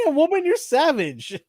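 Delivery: high, stunned mock exclamation; shocked reaction. Reacting to the sister news, a high shocked mock exclamation. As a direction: react like you can't believe she said that. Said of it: "I was like".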